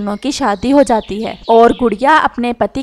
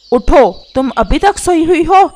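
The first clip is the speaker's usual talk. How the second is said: higher, louder wake-up call; loud scolding; 5 semitones higher, 5 dB louder than usual. Acted. Husband rousing his sleeping wife, loud and scolding. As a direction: rouse a sleeper with a raised, scolding voice. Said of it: "तुम इतनी ऊंची आवाज में क्यों बात कर रहे हो".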